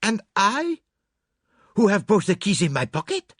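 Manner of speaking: high, loud incredulity; Poirot. Acted. Poirot holds both keys himself, incredulous outburst. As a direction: protest in high-pitched, accented disbelief. Poirot dumbfounded at open case.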